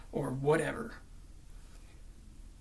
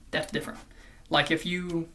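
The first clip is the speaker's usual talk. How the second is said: ordinary delivery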